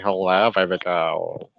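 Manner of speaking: slow, low mock come-on; hammed-up flirty greeting; flirting. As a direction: lay on a drawn-out pickup line. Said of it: "I'm gonna start flirting and saying".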